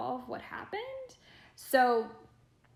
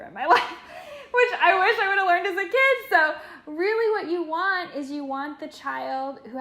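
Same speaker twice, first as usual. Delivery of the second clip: laughing loud and higher through the words; 8 semitones higher, 11 dB louder than usual. Real, not acted. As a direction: laugh at yourself as you say it.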